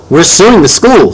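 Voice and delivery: bright, eager announcement; excited. A narrator's reading. Mom telling son the lawsuit news, excitedly. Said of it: "said Mom excitedly".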